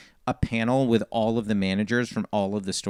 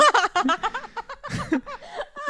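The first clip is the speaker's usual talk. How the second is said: long high burst of laughter; laughing. Real, not acted. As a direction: laugh hard at your own story. Laughs at own retold line.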